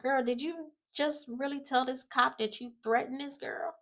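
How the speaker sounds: put-on high, disbelieving girl call-out; sassy high disbelief; incredulous call-out. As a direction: talk to the TV like you can't believe her. Reacting to TV cop scene.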